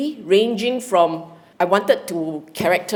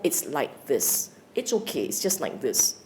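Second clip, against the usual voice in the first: calm acceptance; 6 semitones lower, 8 dB quieter than usual. Retold or imitated. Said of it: "and you go".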